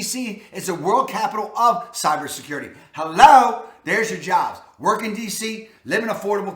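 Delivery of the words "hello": loud, punchy "Hello"; loud "Hello" to the viewer, as in "duh"; emphatic hello. Real, not acted. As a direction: blurt it out like it's obvious. Exclaiming over job abundance.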